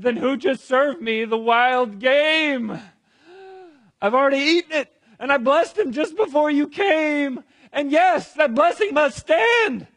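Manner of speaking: put-on loud, high, distraught old father; acted alarm, high and building; agitated, trembling outcry. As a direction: cry it out in shock. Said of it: "began to tremble uncontrollably. And he said".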